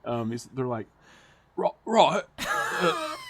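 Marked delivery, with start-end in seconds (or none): 1.6-3.1 badly faked, higher Australian accent; hammed-up Aussie try, breaking into laughter; attempted Australian accent. Co-host asks to hear the Australian, attempted Australian accent. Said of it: "let's hear your Australian".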